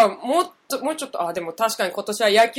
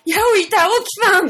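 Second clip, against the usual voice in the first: startled cry; 10 semitones higher, 6 dB louder than usual. Pretend. Startled greeting, acted.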